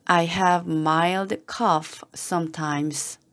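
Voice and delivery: slow, careful, word by word; slow model reading. Shown as a demonstration. Teacher modelling the phrase for learners, slow and careful. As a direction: read it slowly for learners to follow. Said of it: "teacher models slow first reading".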